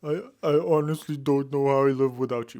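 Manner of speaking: lightly hammed-up Edward; a mild mock of a moody vampire; mocking imitation. Mimicking the movie line just heard.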